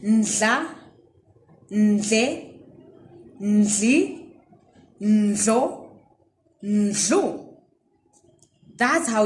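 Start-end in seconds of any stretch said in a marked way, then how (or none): 0.0-7.6 ndla ndle ndli ndlo ndlu, each rising like a prompt; call-and-response drill; drill syllables. Shown as a demonstration. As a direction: lead the class through the drill. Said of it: "Say it after me".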